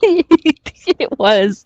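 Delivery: words through real laughter; laughing. Real, not acted. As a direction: laugh as you agree. Laughing at partner's joke.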